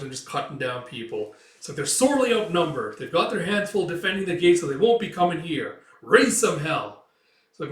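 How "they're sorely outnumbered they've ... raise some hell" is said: plan the raid with relish; cocky leader briefing raiders; confident order. Acted.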